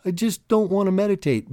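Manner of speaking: put-on high, loud childish whine; whiny inner voice. Inner voice whining about meditating, high petulant whine. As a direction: whine it like a kid who won't meditate. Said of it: "hear my inner voice cry at me and go".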